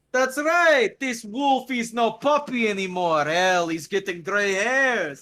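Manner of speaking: high, loud bragging bark, slowing at the end; boisterous old tough guy. Acted. The Mac boasting to Gogol after the wrestling, loud and boisterous. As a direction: crow it like a proud old brawler. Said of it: "stands up and goes".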